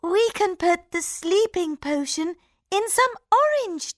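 high scheming princess; playful plotting princess; cartoon princess. A narrator's reading. Princess voiced.

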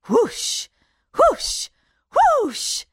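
loud rising whoosh noise; magic gust sound effect; whooshing wind. A narrator's reading.

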